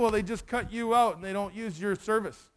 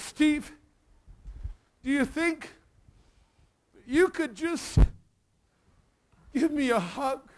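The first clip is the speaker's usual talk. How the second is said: put-on higher, slow, halting plea from a lonely old man; pleading old man; 4 semitones higher than usual. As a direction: voice the old man's timid, shaky request with long pauses. Broken old man asks for hug.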